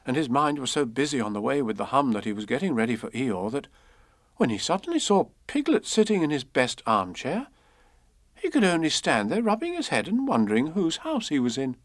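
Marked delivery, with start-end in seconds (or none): none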